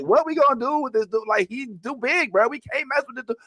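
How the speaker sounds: high-pitched playful fretting; mock dismay. Acts out the hosts' mock dismay.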